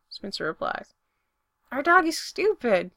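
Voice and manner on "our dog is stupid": higher, louder, delighted declaration; happy. A narrator's reading. Spencer about the dog licking his face, happy. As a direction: say it happily, lifting pitch and volume. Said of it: "Spencer says happily".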